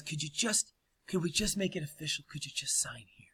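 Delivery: soft whispered coaxing plea. Retold or imitated. Kathleen softly coaxing the director to sign. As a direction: ease the ask in, hushed and sweet.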